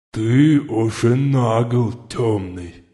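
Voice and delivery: slow lisping lizard voice; slushy lisp. A narrator's reading. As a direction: lisp the words slowly like a reptile. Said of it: "продолжил шепелявый".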